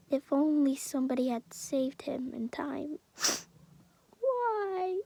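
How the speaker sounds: mother's hushed lament; whispered grief. Acted. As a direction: think it in despair. Grieving mother's inner thought.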